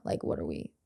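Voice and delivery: breathy whisper to the camera; whispered aside. Retold or imitated. Talking into camera about bad shows, whispered. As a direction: hush it like a secret aside.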